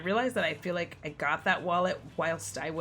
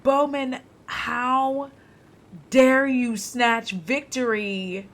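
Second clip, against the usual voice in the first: higher, louder, slow theatrical how-dare-you; mock outrage; 5 semitones higher, 5 dB louder, much slower than usual. Streamer scolding the character Bowman, mock outrage. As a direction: ham up the betrayal like a soap villain. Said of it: "I am so ma".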